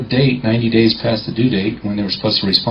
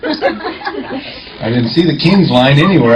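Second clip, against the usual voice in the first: laughing; 6 semitones higher, much slower than usual. Real, not acted. Joking aside about the king's line.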